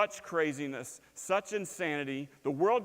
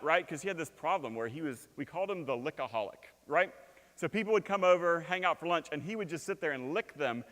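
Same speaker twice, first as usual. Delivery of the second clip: usual speaking voice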